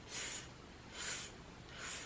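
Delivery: long hissing s; drawn-out s like a snake. Shown as a demonstration. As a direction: hiss s like a snake. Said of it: "like a snake is making a sound".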